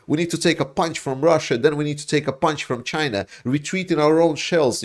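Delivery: sneering impression of pro-Russian Americans begging to retreat; mocking the losers. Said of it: "It's like".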